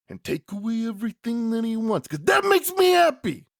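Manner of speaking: high, intense wrestling promo with shouted peaks; Macho Man. Acted. Wrestler cutting a promo to his interviewer, hammed-up yelling impression. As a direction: ramp up from pressured growing excitement to full yells. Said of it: "At which point Mach says".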